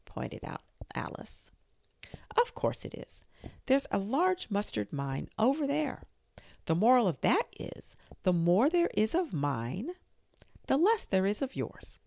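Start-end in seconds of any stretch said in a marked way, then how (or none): 2.4-12.0 a hint of a preachy Duchess. A narrator's reading. Duchess moralizing.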